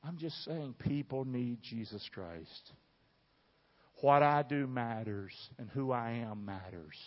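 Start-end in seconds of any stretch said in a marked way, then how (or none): none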